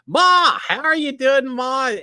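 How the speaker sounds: high, loud call to Ma. Real, not acted. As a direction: holler it across the room to Ma. Calling out to a viewer.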